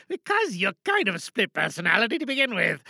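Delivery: Geronimo's other self. Acted. Geronimo's split personality mocks himself, low quiet aside. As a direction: tease the other half quietly. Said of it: "that's Geronimo Stewart".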